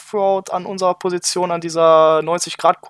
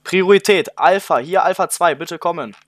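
crisp, louder radio call with priority; military radio; 2 semitones higher, 4 dB louder than usual. Acted. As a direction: call it in like an urgent radio report. Urgent police radio call in roleplay. (dringender Funkspruch, laut und knapp)

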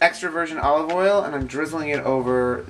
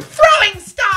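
shout; 10 dB louder, slower than usual. Retold or imitated. Ninja catchphrase shouted on stage.

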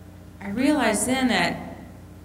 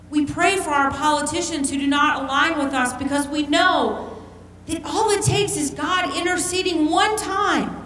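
preaching; 7 semitones higher than usual. Acted. Urging the congregation toward conversion, full-force preaching. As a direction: build it like an altar call.

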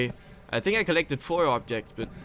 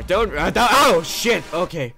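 being chased; 6 semitones higher, 11 dB louder than usual. Real, not acted.

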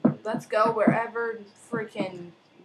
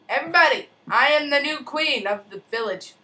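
royal proclamation; 4 semitones higher, 5 dB louder than usual. Pretend. Proclaiming himself queen.

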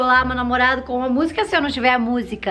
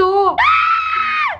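grito de alegria muito agudo; grito agudo de euforia; 3 dB louder than usual. Real, not acted. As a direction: berre de empolgação. Grito de euforia com a resposta. (grito eufórico e agudíssimo)

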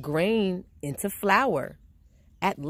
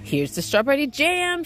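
put-on higher, eager boy's shout; 9 semitones higher, 3 dB louder than usual. A narrator's reading. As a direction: call out the prize. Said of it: "shouted Jack".

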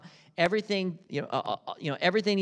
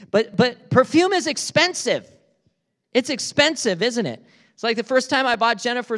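raised, higher preaching voice, driving it home; emphatic preaching; 7 semitones higher, 5 dB louder than usual. Real, not acted. Preacher to congregation, loud emphatic delivery. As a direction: press the point louder and higher. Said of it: "preacher raises voice".

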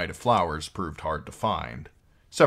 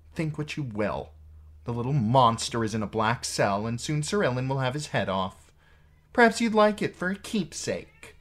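higher-pitched, goading queen; taunting Cersei; 7 semitones higher, 3 dB louder than usual. A narrator's reading. Sister goading her brother about the prisoner, taunting. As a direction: taunt him in a lifted voice.

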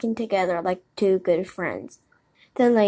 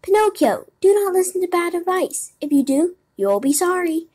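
high-pitched blackbird warning; urgent warning in a raised voice; sharp, clear call; 10 semitones higher, 5 dB louder than usual. A narrator's reading. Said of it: "called out sharp and clear".

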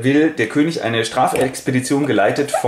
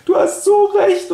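mock wailing; 5 dB louder than usual. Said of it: "fängt wieder ein bisschen an zu weinen".

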